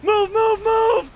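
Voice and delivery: high, loud barked orders to clear the road; frantic high shouting; shouting move. Real, not acted. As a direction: yell traffic out of the way. Yelling at cars blocking.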